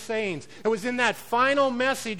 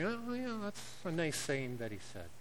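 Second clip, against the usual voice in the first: dismissive shrug; 3 semitones lower, 11 dB quieter than usual. Retold or imitated. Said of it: "We just say".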